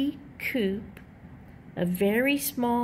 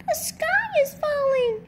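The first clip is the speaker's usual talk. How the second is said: squeaky chick voice crying the sky is falling; panicky peep. A narrator's reading. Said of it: "she peeped".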